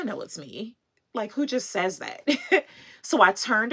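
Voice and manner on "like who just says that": normal talking voice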